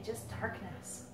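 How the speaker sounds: usual speaking voice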